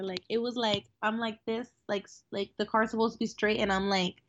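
usual speaking voice

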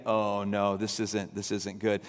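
low, soft inner voice of dismay; thinking it to yourself with sinking dread. Retold or imitated. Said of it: "I'm thinking".

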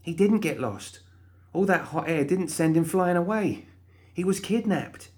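high and hushed; a hint of a whisper in Molly's high voice; Molly, mock whisper. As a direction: keep it secret but eager.

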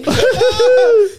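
high, loud burst of laughter; helpless laughter. Real, not acted. Host laughs at guest's disgust, helpless high laughter. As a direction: crack up in a high cackle.

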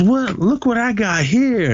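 high, giddy imitation showing off a prize; hammed-up excited squeal; mock tipsy glee. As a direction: squeal it like a drunk friend flaunting her catch. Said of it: "And she goes".

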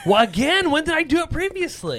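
high, loud, indignant; indignant surprise. Real, not acted. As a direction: protest high and loud, offended. Indignant at teasing.

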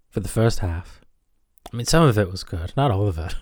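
usual speaking voice

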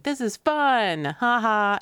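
high, slow, gleeful kid taunt with a mock laugh; slow singsong kid taunt, ha ha tacked on. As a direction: tease like a cheeky kid egging you on. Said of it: "and then say, like".

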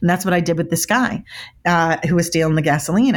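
ordinary talk

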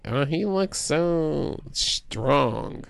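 slow, drawn-out dreamy swoon; swooning wife. Retold or imitated. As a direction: gush over him slowly like a smitten fan. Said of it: "she's like".

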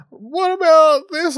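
high, slow sing-song call to another room; calling out to mum. Retold or imitated. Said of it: "sings out to her mum".

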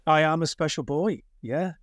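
ordinary talk

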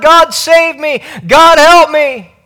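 high, urgent cry for rescue; yelling out to God. Acted. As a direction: call out for help, pitched well up. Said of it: "you're yelling out to God".

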